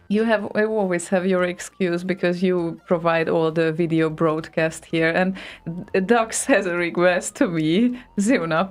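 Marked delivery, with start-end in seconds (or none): none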